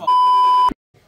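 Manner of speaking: censored with a bleep. Real, not acted. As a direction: swear covered by a bleep. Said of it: "That means I have to bleep that now".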